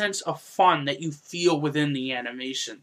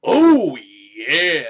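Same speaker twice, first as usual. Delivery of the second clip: sultry sound-effect voice; 2 semitones lower, 9 dB louder than usual. Retold or imitated. Sound effect as the pink-lit room is revealed, sultry. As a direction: imitate a cheesy sexy sound effect. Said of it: "you hear the sound of a".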